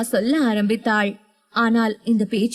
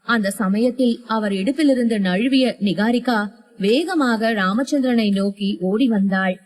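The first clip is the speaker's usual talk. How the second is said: plain reading voice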